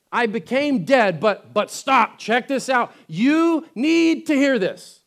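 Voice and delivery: preacher voicing Jesus demanding attention; urgent proclamation. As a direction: grab their attention, insist. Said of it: "So he's like".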